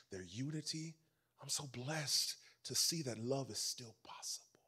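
whispered, tender blessing; hushed prayer. Pretend. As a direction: pray softly, almost breathless with gratitude.

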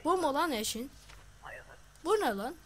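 high, loud disbelief; shocked outcry. Real, not acted. Gamer reacting to a spouse photo, loud and shocked. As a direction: blurt it out in shock.

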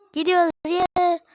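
high, loud happy cheer; excited cheer. Real, not acted. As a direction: cheer as you set off. Cheer setting off.

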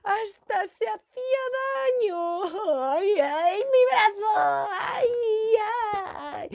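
very high, drawn-out blubbering; mock crying. Moncho hurting his arm in a tantrum, exaggerated crying. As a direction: wail in pain like a small child, hammed up.

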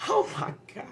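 high, breathless oh my God; real astonishment, half under the breath; stunned gasp. Real, not acted. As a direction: gasp it out in disbelief. Shocked at the card.

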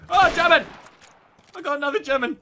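high, loud battle callout; excited shout. Real, not acted. Gamer announcing another German, high excited shout. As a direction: call out the enemy, loud and high.

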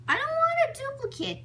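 squeaky high complaint; whiny high protest. Real, not acted. Refusing a duplicate toy.

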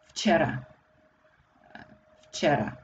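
"Včera" begun with f instead of v. Shown as a demonstration. Teacher showing the easier start of Včera, f for v. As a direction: say "Včera" as "fchera", easy on the tongue. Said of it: "we say f instead of v".

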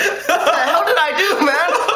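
high, slow, laughing disbelief; stunned disbelief. Retold or imitated. Soaked usher after the glasses hit his face, laughing disbelief. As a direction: cry out, baffled and hurt, half laughing.

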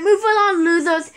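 ordinary talk

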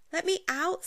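put-on trapped boy pleading with the leprechaun; begging. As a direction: beg to be let out. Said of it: "Sidney begged".

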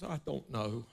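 put-on soft, low little girl saying sir; a guilty child answering her dad; meek daughter. Daughter answers her father's questions, meek and soft. Daughter voiced in retold story.

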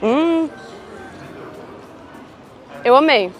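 ordinary talk, nothing marked

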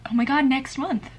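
playful birthday tease to viewers; sudden realization. Real, not acted. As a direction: drag out the guess-who tease.

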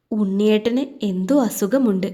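ordinary delivery, nothing marked

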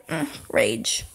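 whispered rage. Real, not acted. Muttering his anger.

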